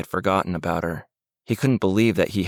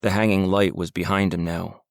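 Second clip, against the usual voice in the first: ordinary delivery, nothing marked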